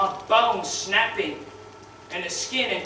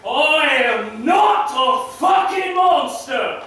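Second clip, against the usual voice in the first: slow, loud roar of outrage, pitched up; furious shout; 5 semitones higher, 5 dB louder, slower than usual. Acted. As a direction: spit every word out slowly and loudly. Furious outburst opening the monologue.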